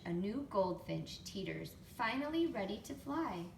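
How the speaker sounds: plain reading voice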